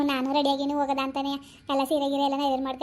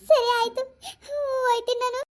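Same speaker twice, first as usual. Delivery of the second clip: higher, sobbing through the words; crying while agreeing to leave; 9 semitones higher, slower than usual. Acted. Granddaughter leaving for the funeral, crying.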